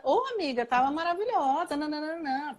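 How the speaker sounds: put-on playful, high-pitched girlfriend praise; gushing reply. Models a gushing reply to friend.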